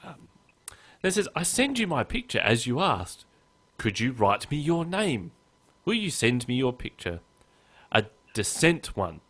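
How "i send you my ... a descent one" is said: lilting, higher-pitched opening that settles down; deliberate, lilting spam-letter voice; mock email reading. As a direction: read out the clumsy email with careful sing-song emphasis.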